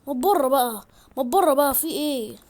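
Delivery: loud, fed-up order to get out; exasperated shout. Real, not acted. Player ordering intruders out of the house, exasperated shout.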